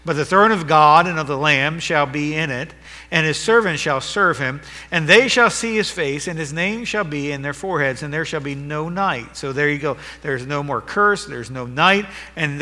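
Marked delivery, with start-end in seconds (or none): 0.1-9.4 ringing pulpit proclamation; preaching at full force. Acted. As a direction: declare it to the whole church. Reads verses with force.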